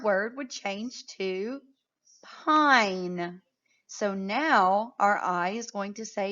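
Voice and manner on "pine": normal talking voice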